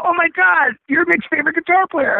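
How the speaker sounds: high-pitched mock fan gushing; very high, excited retold exclamation; thrilled recognition. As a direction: act out squealing, starstruck recognition. Acting out thrilled recognition at airport.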